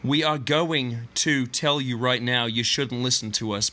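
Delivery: normal talking voice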